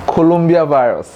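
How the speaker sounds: "Colombia virus" in place of coronavirus; mispronounced coronavirus. Shown as a demonstration. Teacher quoting what people say, coronavirus garbled as "Colombia virus". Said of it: "what people mostly say".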